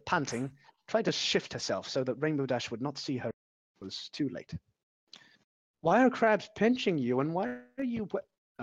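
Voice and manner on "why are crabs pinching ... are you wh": confused. Acted. Dash voiced by reader.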